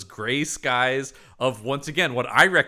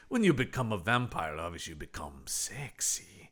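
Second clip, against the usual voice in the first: fading into a mock sultry whisper, much softer; mock breathy whisper; 6 semitones lower, 8 dB quieter than usual. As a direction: drop into a hammed-up seductive whisper. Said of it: "he just goes".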